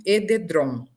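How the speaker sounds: "edredom" pronunciado ededrom; R transposto. Shown as a demonstration. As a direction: diga "edredom" como e-de-drom. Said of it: "Algumas pessoas". ("edredom" com o R na última sílaba)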